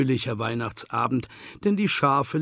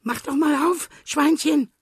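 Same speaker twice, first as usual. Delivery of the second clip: nervous; 7 dB louder than usual. A narrator's reading. Frightened fox hiding under sofa.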